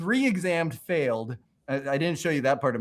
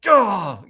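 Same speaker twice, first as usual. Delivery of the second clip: loud, higher "ugh" of self-anger; angry groan; 7 semitones higher, 7 dB louder than usual. Retold or imitated. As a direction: let out an irritated grunt. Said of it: "I just get angry".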